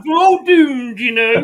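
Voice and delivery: Dad's Army Scottish doom-monger; Scottish accent. Retold or imitated. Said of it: "that remember Dad's Army".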